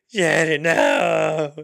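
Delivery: high, loud hammed-up "Janet, no!"; mock the actor's overacted shout; overacted cry. Mocking a bad actor's scene, hammed-up loud cry. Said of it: "It's just, it's so bad".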